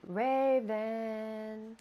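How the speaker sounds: drawn-out ghostly call of a name; eerie calling voice. Acted. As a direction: call the name like something not human. Mimic calling name eerily.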